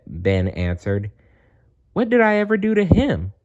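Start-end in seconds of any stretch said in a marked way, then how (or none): 1.9-3.3 high, fast hurt whine; a kid protesting how unfair it is, high and quick; hurt child's squeaky complaint; upset whine. Acted. Ben upset about bully.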